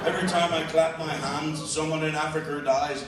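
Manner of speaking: usual speaking voice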